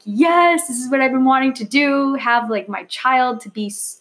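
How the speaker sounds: over-the-moon parent; excited. Retold or imitated. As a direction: gush about the dream coming true. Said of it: "And I'm like".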